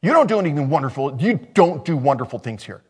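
acted indignant scolding, quick bursts; indignant, with a sharp rise at the start; mock indignation. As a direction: play offended critics telling someone off. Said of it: "they were angry".

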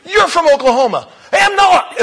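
high and loud, hammed-up exchange; preacher acting out a shouted dialect quarrel; loud comic shouting. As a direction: shout the accusation and the denial. Comic shouted accusation and denial.

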